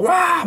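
high imitated crowd roar; mock cheer answering a chant leader. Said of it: "누가 막 선창하면 막". (높게 내지르는 함성 흉내)

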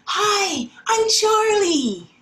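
put-on high, slow little-boy greeting; shy new kid. Teacher voicing Charlie for her class, high and slow. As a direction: say hello like a small boy meeting a friend.

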